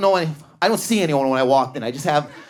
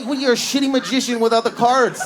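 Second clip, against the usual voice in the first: usual speaking voice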